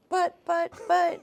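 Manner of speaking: high-pitched, halting objection; playfully flustered, high and halting; mock sputtering protest. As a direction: stammer it like an imitated helpless protest. Retold protest to producer's reassurance.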